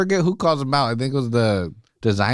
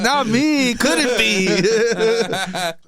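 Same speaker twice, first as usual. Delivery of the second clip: high, slow, laughing fake innocence; laughing mock-innocent high voice; 10 semitones higher, much slower than usual. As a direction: ham up a guilty denial. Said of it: "He's like".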